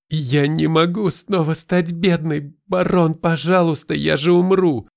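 high, loud pleading near tears; desperate pleading. Acted. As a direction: beg him as if near tears. Stepmother pleads not to be poor.

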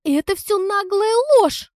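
loud, very high-pitched squeal of protest; outraged screech; shrieking. A narrator's reading. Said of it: "взвизгнула она".

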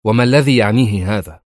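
mildly fed-up question; irritated. A narrator's reading. Tia questioning her boastful host, mildly irritated. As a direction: ask with a touch of impatience. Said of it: "سألته تيا في ضجر".

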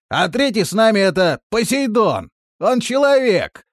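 high, loud announcement called downward; shouting. A narrator's reading. Mustar introducing the third companion to Biota, loud shout. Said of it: "крикнул Мустар".

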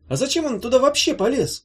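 high, loud puzzled question; a hint of young Kornelij; lightly voiced curious youth. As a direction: ask with a touch of youthful surprise.

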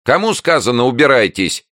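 high, loud neighbour yelling; angry shout. A narrator's reading. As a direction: bellow an order through the door. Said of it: "закричал голос из-за соседней двери". (сердитый крик из-за двери)

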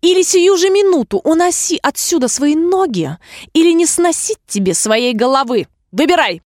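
high, imperious ultimatum; angry shouted threat. A narrator's reading. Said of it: "крикнула королева". (гневный повелительный окрик)

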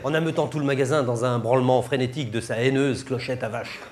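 normal talking voice

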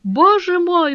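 high, drawn-out despairing cry; broken, near sobbing. A narrator's reading. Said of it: "в котором слышались рыдания".

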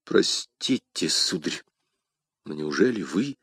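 low, soft, hesitant question; потрясённое извинение. Acted. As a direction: apologise softly, hardly daring to ask. Awed apology on recognising a duke. (тихо, низко, с робостью)

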